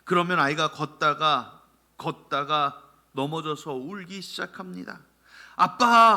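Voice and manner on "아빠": put-on loud high child's cry for dad; child calling dad. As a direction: call out like a fallen toddler. Crying child calls father.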